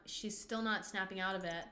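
ordinary talk, nothing marked

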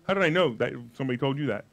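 usual speaking voice

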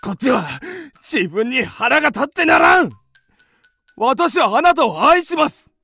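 exasperated, shouted love confession; angry with himself. Acted. Man declaring love to the woman, furious with himself.

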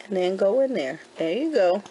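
ordinary talk

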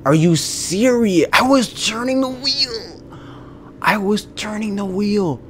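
angry whining. Real, not acted. Gamer protesting he was turning the wheel, angry whining. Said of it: "I'm so angry".

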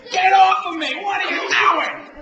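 shouting. Real, not acted. Being pinned, shouting.

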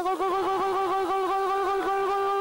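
high sustained goal call; goal scream. Real, not acted.

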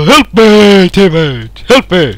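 loud, urgent plea; calling for help. Real, not acted. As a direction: shout to a teammate to help. Calls to teammate for help.

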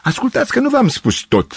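high-pitched, melodramatic appeal; pathetic cry. A narrator's reading. Gavrilescu appealing to the girls to listen, pathetic cry. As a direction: cry it out with overdone pathos. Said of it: "strigă patetic Gavrilescu".

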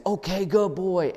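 high, drawn-out praise; puppy praise. Shown as a demonstration. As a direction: praise a puppy, stretching the words. Demonstrates praise given to puppy.

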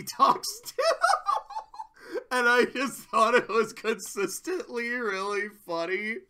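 amused, voice lifting into giggles; laughing while talking. Real, not acted. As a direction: talk through a real laugh. Laughing at a funny plot detail.

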